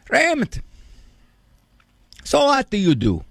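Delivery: put-on high called Raymond, then a lightly Italian-accented question; a hint of an old Italian grandpa quizzing his grandson; Italian grandfather voice. Papo asks his grandson about his job, light Italian accent. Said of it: "in his broken Italian accent".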